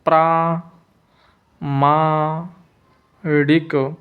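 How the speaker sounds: प्रामाणिक very slowly, प्रा-मा-णिक; drawn-out, separated syllables in प्रामाणिक; slow dictation. Shown as a demonstration. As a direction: hold each long आ in प्रामाणिक and pause after it. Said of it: "प्रामाणिक कैसे लिखते हैं".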